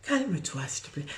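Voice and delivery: a hint of a serene parent soothing fighting kids; gently calm them down, mock-serene. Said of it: "Et on leur dit".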